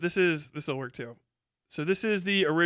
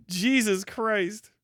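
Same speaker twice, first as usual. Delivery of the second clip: ordinary talk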